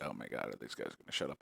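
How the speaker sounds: low, quiet, fed-up groan; bored viewer impression. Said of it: "people are sitting here like".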